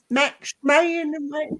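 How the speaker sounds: slow, stretched Southern drawl on Max Mayonnaise; slurred Southern drawl, Mayonnaise stretched. Shown as a demonstration. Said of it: "Southern drawl".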